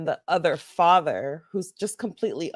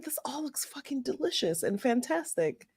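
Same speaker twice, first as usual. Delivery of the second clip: soft awed quote; 8 semitones higher, 10 dB quieter than usual. Retold or imitated. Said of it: "I was like".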